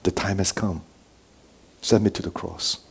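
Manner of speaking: low-key, soft, grave request; solemn and hushed. Acted. Preacher voicing the Son to the Father, hushed resolve. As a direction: say it quietly, with space between phrases. Repeats the Son's words softly.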